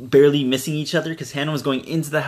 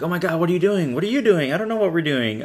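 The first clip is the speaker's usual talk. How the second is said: higher, frantic retold panic; mock panic; 6 semitones higher than usual. Two friends run into each other in the house, mock panic. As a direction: act out the startled friends, pitched up.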